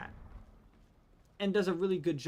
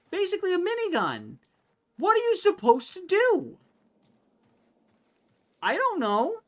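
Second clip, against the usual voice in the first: high, loud exasperation; exasperated outburst; 12 semitones higher, 6 dB louder than usual. Real, not acted. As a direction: throw up your hands, loud and high. Exasperated rant.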